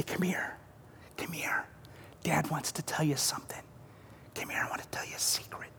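unvoiced secret whisper to a child. Dad whispering to his young child, playful whisper. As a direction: coax the child close with a whisper. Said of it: "you even begin to whisper".

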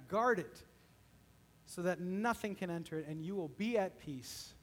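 soft, hushed pastor's prayer; closing prayer. Acted. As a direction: pray it quietly over the people.

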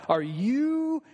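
mock him with chest puffed out; scornful Pharisee rising in pitch. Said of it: "chests all puffed out".

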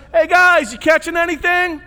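loud friendly call across the water. Retold or imitated. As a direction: shout out to fishermen far away.

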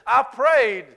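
loud, high, aggrieved complaint to God; mock sulky believer crying out to God; entitled whining. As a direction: whine at God that you deserve better. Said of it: "it will look like this".

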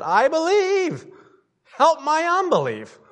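high, loud, desperate cry to Jesus. Acted. Father crying out to Jesus for his son, high and loud. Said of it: "the father of the child cried out and said".

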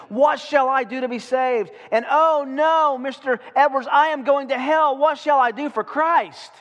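high, loud panicked cries of a terrified congregation; frantic screaming crowd. Retold or imitated. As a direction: wail it like sinners begging to be saved. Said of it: "People were screaming".

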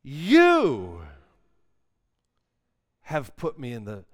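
preacher shows how to pray the lament, slow with heavy stress; emphatic psalm reading. Shown as a demonstration. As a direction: punch You, wrath and overwhelm, slow and loud. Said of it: "see the word that I emphasize".

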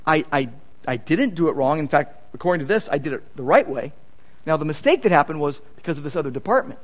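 respectful reply. Retold or imitated. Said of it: "very respectfully says".

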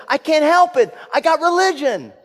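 high, loud, fervent plea; pleading. Retold or imitated. Boy to ushers throwing him out, pleading. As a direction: protest helplessly at the top of your range. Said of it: "he pleaded with them".

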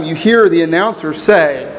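normal talking voice

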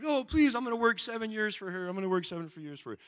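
hammed-up pleading suitor; pleading Jacob. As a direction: beg like a lovesick young man.

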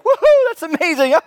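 high, loud cartoon Mario cheer. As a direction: whoop it up like Mario. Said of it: "I sound like Mario".

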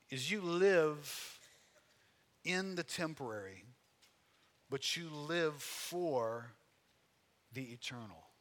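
very slow, with long pauses between phrases; slow, weighty emphasis. Real, not acted. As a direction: stretch "live" and "for", pausing after each phrase. States key point, then repeats it.

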